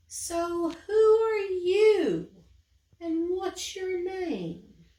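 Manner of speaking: high, loud, slow amazement; surprised question. Acted. Eliza amazed to find a little creature outside. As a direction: ask it wide-eyed.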